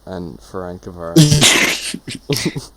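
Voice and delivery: "Ferenc Varosi" stretched out slowly and loudly; drawn-out pronunciation. Pretend. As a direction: drag out "Ferenc Varosi" like a hard name. Mangling a hard club name.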